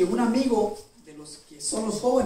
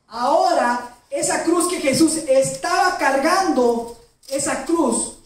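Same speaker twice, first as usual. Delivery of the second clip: higher, louder, slow on "esa cruz"; rising preaching climax; 5 semitones higher, 4 dB louder, slower than usual. Acted. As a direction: build to the turning point slowly. Clímax del sermón. (clímax de sermón, más agudo y fuerte, lento)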